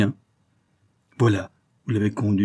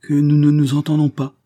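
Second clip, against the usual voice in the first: contained violence; 6 semitones higher, 6 dB louder than usual. A narrator's reading. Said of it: "avec une violence contenue".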